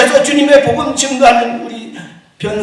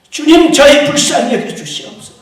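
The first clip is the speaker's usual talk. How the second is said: 주님 cried out louder and higher, then 저희 불쌍히 여겨 주시옵소서; louder plea; 4 semitones higher, 7 dB louder than usual. Real, not acted. Pastor repeating the plea for mercy, more urgently.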